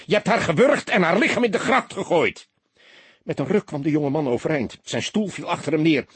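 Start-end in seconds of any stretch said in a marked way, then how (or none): 0.1-2.5 much higher, loud furious shout. A narrator's reading. Detective shouting back at the accused soldier. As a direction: yell it back at him. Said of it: "schreeuwde hij".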